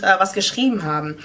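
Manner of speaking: ordinary talk, nothing marked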